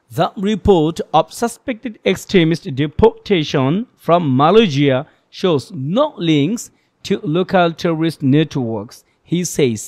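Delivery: very slow, phrased reading with even pauses; model reading. Shown as a demonstration. Teacher reads the full sentence as a model, slow and phrased. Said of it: "এই হচ্ছে পড়া".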